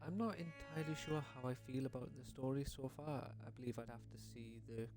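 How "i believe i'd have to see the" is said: usual speaking voice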